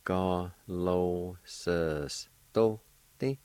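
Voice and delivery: "Galosostote" slowly, in separate syllables with short pauses; separated syllables. Shown as a demonstration. Teacher repeating the word for fabricate, "Galosostote" slow and divided. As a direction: draw out each syllable of "Galosostote". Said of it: "pronounced like this".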